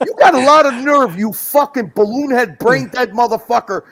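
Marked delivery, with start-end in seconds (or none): none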